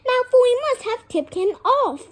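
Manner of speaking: voiced dialogue, pitched well up; urgent character voice. A narrator's reading. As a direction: blurt it out high and urgent. Acted line of dialogue in story.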